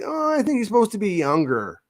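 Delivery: lightly voiced Lucas, casual; George Lucas impression. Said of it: "And Lucas was like".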